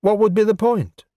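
high-pitched challenge; demanding. Acted. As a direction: demand an answer, voice pitched well up. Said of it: "she demanded".

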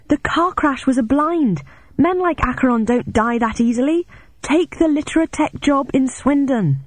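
high, sustained, emphatic call; excited calling out. A narrator's reading. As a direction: call out the message high and deliberately. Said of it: "I shouted excitedly".

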